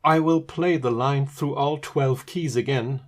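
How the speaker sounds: ordinary delivery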